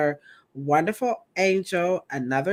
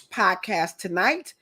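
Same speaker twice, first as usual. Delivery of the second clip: ordinary talk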